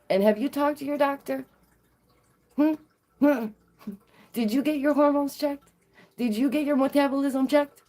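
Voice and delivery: high, pointed mock questioning; mock concern. Throws the prying questions back at friend.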